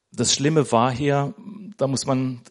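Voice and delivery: ordinary talk, nothing marked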